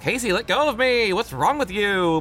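angry cry. Acted. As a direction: snap at a brother who is holding you back. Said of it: "Margaret cried angrily".

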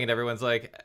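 usual speaking voice

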